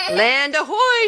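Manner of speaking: loud, high cry of land sighted; sailors' shout. Acted. Mother voicing sailors sighting land, shouting. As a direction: hail it from the deck with joy. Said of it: "shouted the sailors".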